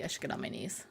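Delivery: near-whispered, very soft; quiet private aside in a whisper. Real, not acted. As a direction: murmur it under your breath, barely voiced. Quiet suggestive aside to self.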